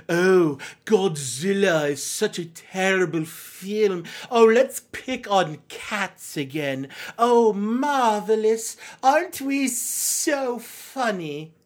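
slow, drawn-out mocking snob voice; sarcastic film snob. Host mocking Razzie film nerds, slow hammed-up sarcasm. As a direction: drag it out with smug, sneering sarcasm.

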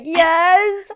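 high, loud mock wail; mock emotional. Admitting to him it's her hormones, mock emotional. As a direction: wail it high, playing overwrought.

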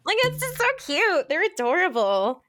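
high, squealing delight; gushing. Real, not acted. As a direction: squeal over how cute it is. Gushing over a cute couple.

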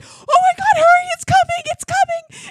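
very high, loud frantic yell; about to vomit, begging to hurry. Retold or imitated. Husband about to vomit at the door, frantic high yell. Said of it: "he's like".

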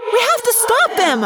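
very high, pleading shout; high, desperate protest; outraged cry. Acted. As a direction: demand action in anguished outrage. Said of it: "Cassie cried, outraged".

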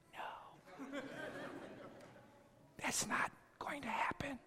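firm breathy whisper of refusal. Retold or imitated. Pastor quietly refusing the lady, whispered firm no. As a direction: say no in a hushed whisper.

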